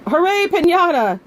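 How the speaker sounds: high, bright burst of joy; excited cheer. Acted. Clara thanks Samson for the piñata, cheering high. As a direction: cheer like it's the best gift.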